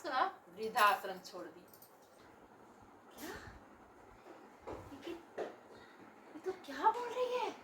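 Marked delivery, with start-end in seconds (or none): 3.2-7.5 slow, higher stunned mutter to herself; shocked aside. Acted. Mother stunned by daughter's confession, slow shocked mutter. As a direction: let the shock slow every word.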